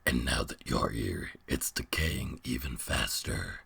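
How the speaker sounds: very low, distant beast voice; deep monster voice. Acted. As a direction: speak as a huge ancient beast.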